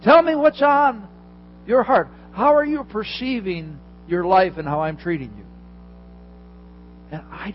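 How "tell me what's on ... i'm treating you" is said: God begs Elijah to open his heart, high and loud; God pleading. Acted. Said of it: "that's what he's saying".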